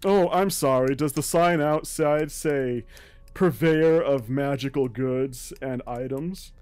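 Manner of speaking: mock apology, dripping with scorn; sarcastic sneer.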